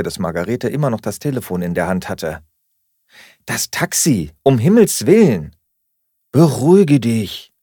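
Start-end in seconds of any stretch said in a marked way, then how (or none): 3.4-5.5 louder, rising impatient outburst; exasperated urging. A narrator's reading. Remembers the forgotten taxi. 6.3-7.5 slow, louder, emphatic reassurance; firm calming-down. A narrator's reading. Flatmate answers the panic.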